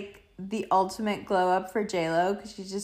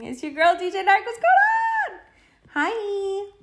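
hyped intro; 10 semitones higher, 5 dB louder than usual. Real, not acted. Podcast intro, hyped greeting.

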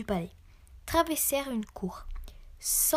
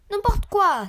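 loud, high dismissive outburst; exclaiming; 8 semitones higher, 7 dB louder than usual. A narrator's reading. Mr. Malpoli retorts to the king, exclaiming. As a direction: burst out in protest, high and loud. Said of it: "s'exclama monsieur Malpoli".

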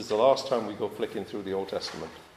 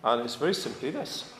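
ordinary talk

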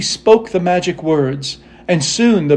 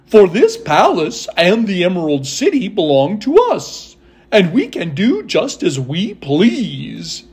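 contented Scarecrow ruler. Acted. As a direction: savor each phrase as a pleased new ruler.

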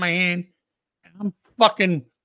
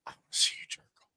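retold whisper; 12 dB quieter, much faster than usual. Retold request, whispered, then asked to repeat.

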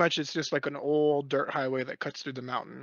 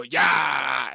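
retold shout; 5 dB louder than usual. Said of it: "and you go".

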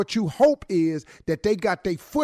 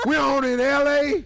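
hype shout; 6 semitones higher than usual. Acted. Hype roll call.

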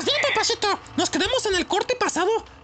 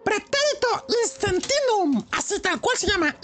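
normal talking voice

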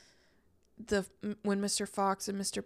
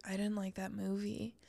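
whispered; 8 dB quieter than usual. Retold or imitated. Recalling her own reaction.

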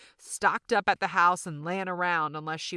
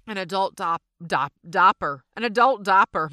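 "an adult dopper", diaper said as "dopper" in a Southern accent, louder; 9 dB louder than usual. Real, not acted. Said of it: "My Southern accent just really came out".

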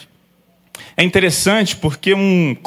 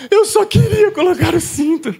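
higher, sing-song imitation of a crying child; mock crying child; 9 semitones higher than usual. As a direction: whimper it like the daughter after losing the seatbelt. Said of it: "ela começa a chorar". (choro de criança imitado, agudo e cantado)

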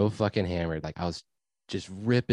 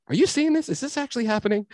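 squeaky-high incredulous aside; acted-out high-pitched astonishment; mock disbelief; 4 dB louder than usual. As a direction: pitch it way up like you can't believe your eyes. Acts out silent disbelief to other guy.

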